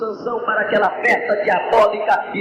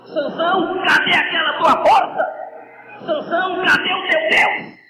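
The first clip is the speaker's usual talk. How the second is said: mocking Philistines; 7 semitones higher than usual. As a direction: jeer at the blind captive. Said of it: "E eles diziam".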